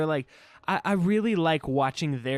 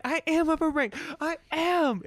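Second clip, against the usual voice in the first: high, whiny protest; whiny demon girl; 13 semitones higher than usual. Retold or imitated. Fan mocking the whining demon, high and whiny. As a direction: whine like a spoiled villain. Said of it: "she was so whiny".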